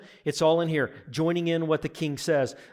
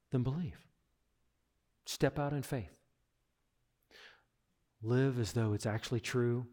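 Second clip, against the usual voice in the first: quiet altar call; 5 semitones lower, 6 dB quieter than usual. Acted. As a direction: draw them in gently, almost pleading.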